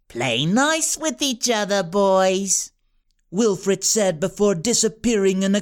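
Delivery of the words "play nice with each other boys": scheming villain. A narrator's reading. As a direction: purr the threat down at them. Wilfred mocking farewell.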